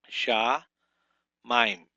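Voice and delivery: Sha, mime. said as two loud separate beats with a pause between; syllable-by-syllable teaching demo; split into syllables. Shown as a demonstration. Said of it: "it's two syllables".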